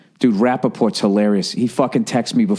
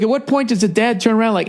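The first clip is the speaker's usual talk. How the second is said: ordinary delivery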